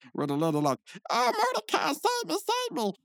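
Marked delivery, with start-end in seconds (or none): 1.1-2.9 much higher, slow damsel cry; mock damsel. Tied-up lady begging Mordecai to save her, high and pleading. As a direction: play a helpless damsel calling her hero.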